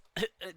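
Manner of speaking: pointed, exaggerated ahem; loud throat clearing. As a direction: clear the throat to get attention.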